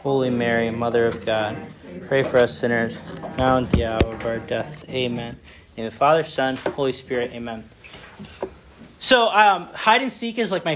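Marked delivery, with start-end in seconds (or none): 0.0-5.4 low, even recited prayer. Real, not acted. Leading the church in the Hail Mary, recited prayer. 5.4-7.6 prayer. Real, not acted. Closing sign of the cross.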